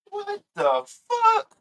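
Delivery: high, slow, stretched disbelief; stunned. Real, not acted. Reacting live to Diva's comeback, a high slow stunned gasp. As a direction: drag out the shock.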